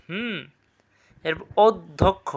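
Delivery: normal talking voice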